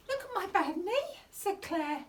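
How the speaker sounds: ordinary delivery, nothing marked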